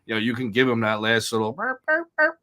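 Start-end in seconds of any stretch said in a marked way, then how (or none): 1.5-2.4 high squeaky burp burp like a turkey call; imitating turkey call; mock turkey burps. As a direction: chirp it up high like a hen turkey. Mimicking turkey call sounds.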